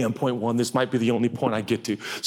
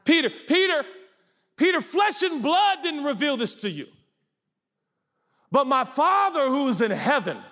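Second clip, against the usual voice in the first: Jesus praising Peter. Acted.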